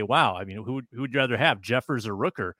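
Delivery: ordinary talk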